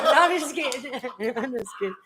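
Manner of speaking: laughing through the words. Real, not acted. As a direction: chuckle while saying it. Laughing after joke.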